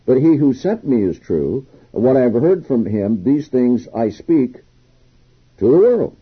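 reading the Bible passage aloud with weight; scripture reading. Acted. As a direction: proclaim the verse to the congregation.

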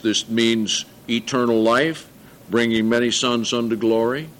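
usual speaking voice

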